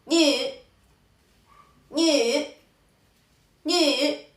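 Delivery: ñe held long and high, dipping; third-tone demo. Shown as a demonstration. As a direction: model ñe as a rounded nü, stretched. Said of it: "Tenemos el tercer tono". (ñe en tercer tono, alargado)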